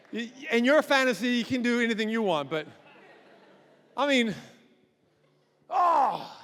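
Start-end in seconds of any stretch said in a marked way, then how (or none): none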